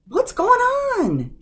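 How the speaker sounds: very high, loud, slow "what's going on"; confronting. Shown as a demonstration. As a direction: demand it, ready to start a fight. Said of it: "you're questioning the person".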